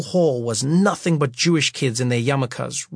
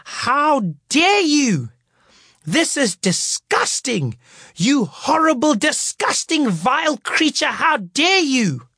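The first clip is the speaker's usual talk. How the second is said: livid teacher; 11 semitones higher, slower than usual. Retold or imitated. Teacher scolding the performers, mock outraged. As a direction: play a furious teacher, dragging words out. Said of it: "she was livid".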